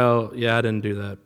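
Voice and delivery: low, soft, offhand admission; lightly voiced slacker owning up; lazy employee. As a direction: imitate an unbothered slacker, low and quiet. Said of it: "my lazier employee".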